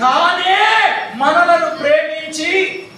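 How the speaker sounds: slow, drawn-out dictation; slow teacher prompt. Shown as a demonstration. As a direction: spell it out slowly for a child. Teacher prompts child's reading.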